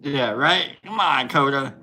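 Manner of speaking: ordinary talk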